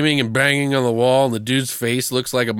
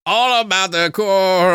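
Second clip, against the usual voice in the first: gleeful singsong; 7 semitones higher, slower than usual. Retold or imitated. Gleeful slasher riff, laughing.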